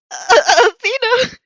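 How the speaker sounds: very high, loud, stretched-out yelp; flustered boy squeaks in shock; embarrassed shriek. Acted. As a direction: shriek the name in mortified panic. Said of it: "in an embarrassed shriek".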